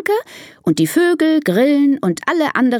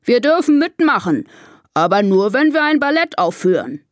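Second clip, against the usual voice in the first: lightly voiced elephant boss, raised pitch; trumpeting elephant chief; 5 semitones higher than usual. Elephant chief reading news to his herd, lightly trumpeted. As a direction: announce it like the herd's chief. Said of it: "trompetet Karl".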